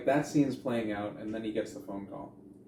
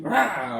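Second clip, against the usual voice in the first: sudden loud, raised "rah"; imitated monster roar; 7 semitones higher, 10 dB louder than usual. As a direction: burst out with a mock attack roar. Mimics sudden attack across the room.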